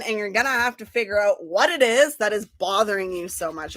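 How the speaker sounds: ordinary talk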